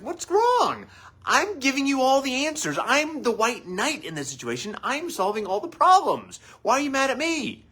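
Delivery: a hammed-up husband who can't see why she's mad; baffled defensive husband. Husband protests to his upset wife, high and indignant.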